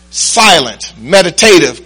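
ordinary talk

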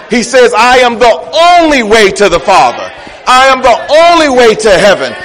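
high, forceful sermon delivery; full-force preaching. Acted. Preacher rousing the church against watered-down gospel, full-force preaching. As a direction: drive each declaration home to the congregation.

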